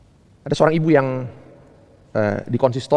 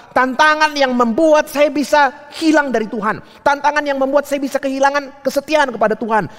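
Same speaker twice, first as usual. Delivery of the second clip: high, driving preacher's build; fervent sermon crescendo on repeated phrases; impassioned preaching; 11 semitones higher than usual. Real, not acted. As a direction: preach it hard, pitch held high.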